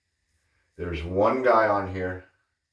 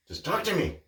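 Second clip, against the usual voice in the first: acted stranger; 9 semitones higher, 3 dB quieter than usual. Retelling a stranger's odd demand, high-pitched mimicry.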